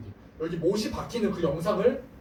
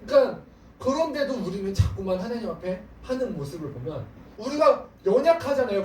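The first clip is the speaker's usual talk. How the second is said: ordinary delivery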